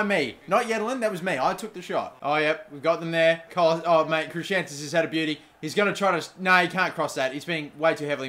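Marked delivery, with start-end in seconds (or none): none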